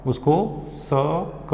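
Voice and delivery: drawn-out syllables; slow phonics sounding; sounded out slowly. Shown as a demonstration. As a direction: stretch each sound apart for the class. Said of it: "देखो कैसे पढ़ते हैं".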